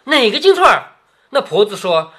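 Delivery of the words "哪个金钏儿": higher, louder startled question, read aloud; lightly acted alarm, pitched up. A narrator's reading. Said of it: "袭人吓了一跳".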